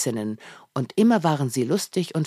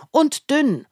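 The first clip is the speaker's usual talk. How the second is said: Tim; 11 semitones higher, 4 dB louder than usual. A narrator's reading. Tim answers father eagerly.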